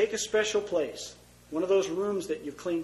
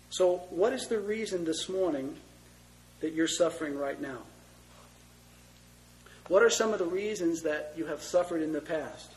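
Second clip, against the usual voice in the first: ordinary delivery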